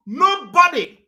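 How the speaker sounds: NOBODY high and loud; loud emphatic. Real, not acted. Speaker barring all politicians from Biafra, loud emphatic. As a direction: cry out "nobody" as a ban. Emphatic repeat in angry vow.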